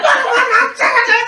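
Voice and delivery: high, loud mock outburst; retold spazzing out. Reliving the fight in the street, retold furious yelling. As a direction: yell the curse over and over, hammed-up. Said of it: "I spazzed on him".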